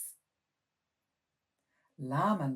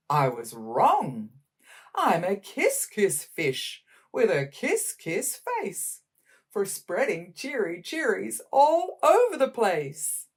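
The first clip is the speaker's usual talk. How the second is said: loud, happy fish voice, smooches climbing much higher; cheerful kiss-kiss fish; 6 semitones higher, 6 dB louder than usual. Acted. Fish to his friends, loud delighted smooching. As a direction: sing out the smooches, rising with joy. Happy fish's new refrain.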